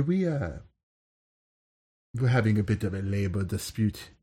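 put-on lightly done French Algerian accent; a touch of a North African French lilt. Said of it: "that's a French Algerian accent".

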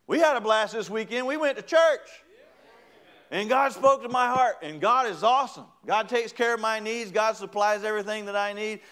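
loud, high, jubilant testimony; excited boasting. Acted. Believer telling coworkers about church, jubilant boasting. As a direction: shout it proudly like weekend news.

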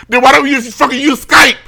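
high, loud indignant burst; exasperated outburst. Acted. Co-worker fed up with Teams over Skype, exasperated. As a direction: blurt it out at the top of your range. Exasperated rant about Microsoft programs.

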